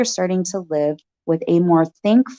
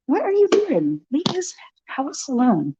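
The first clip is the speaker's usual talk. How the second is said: high, sharp scolding call; 7 semitones higher, 3 dB quieter than usual. Real, not acted. A farm owner scolding an animal near the house, high and sharp. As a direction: shoo it off from the house.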